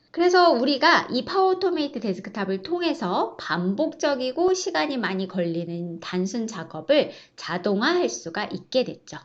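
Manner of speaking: normal talking voice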